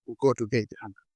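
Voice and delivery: normal talking voice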